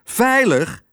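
high, incredulous outburst; indignant yell. A narrator's reading. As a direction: throw it back as an outraged question. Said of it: "schreeuwde Lodewijk". (verontwaardigde uitroep)

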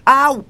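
high yelp of hurt; sharp cry of pain. A narrator's reading. Porter bitten on the nose, yelping high. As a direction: yelp as the teeth go in.

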